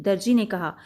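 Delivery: plain reading voice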